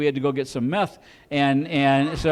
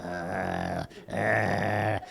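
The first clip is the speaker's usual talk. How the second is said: much lower, soft grunts; Chihuahua grunt; 9 semitones lower, 10 dB quieter than usual. Pretend. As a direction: grunt dismissively like a tiny dog. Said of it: "turn around once in a while and go".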